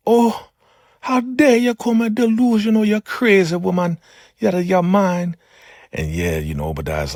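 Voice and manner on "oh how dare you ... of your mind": put-on high, loud, outraged old woman; how dare you, screeched high; indignant shriek. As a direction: shriek it like an offended elder. Elder Mother indignant outburst.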